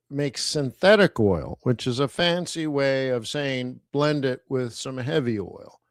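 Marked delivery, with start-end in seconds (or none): none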